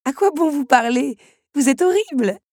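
high, loud laughing outburst; laughing protest. Acted. Belle laughing at the duke's joke, high and loud. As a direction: scold him through laughter. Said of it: "Belle éclata de rire".

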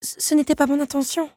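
high, nervous stammer. A narrator's reading. Belle stammering her excuse, scared. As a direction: trip on the first word, voice up high. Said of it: "bourdouilla la jeune femme".

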